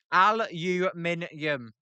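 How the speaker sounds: "Aluminium" slowly as al-yoo-MIN-yum; "Aluminium" drawn out with a y glide; slow careful attempt. Shown as a demonstration. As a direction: stretch out "Aluminium" syllable by syllable. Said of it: "I can't say it".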